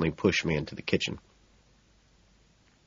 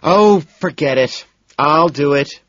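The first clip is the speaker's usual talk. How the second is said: loud, high exasperated outburst; harassed; 8 semitones higher, 8 dB louder, slower than usual. A narrator's reading. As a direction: throw up your hands in exasperation. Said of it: "seemingly harassed".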